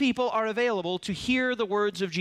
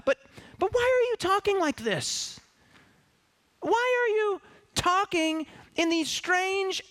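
high, pleading, bewildered voice; high exasperated plea to Jesus; exasperated disciples; 6 semitones higher than usual. Acted. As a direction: voice the baffled disciples begging Jesus. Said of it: "you almost hear them in the subtext saying".

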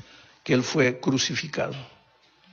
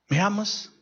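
voice of Jesus; 8 semitones higher than usual. Acted. Jesus questions Peter on beach.

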